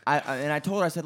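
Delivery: ordinary talk, nothing marked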